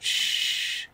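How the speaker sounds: long soft shush; drawn-out, quiet shh; hushing shh. Acted. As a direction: hush them quietly. Hushing companion during kidnapping scene.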